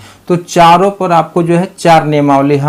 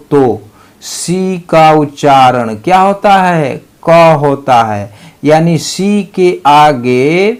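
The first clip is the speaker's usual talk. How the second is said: slow rule reading; much slower than usual. Shown as a demonstration. Teacher dictates rule one to the class, slow. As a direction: rule read out very slowly, word by word. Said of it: "नियम one क्या कहता है".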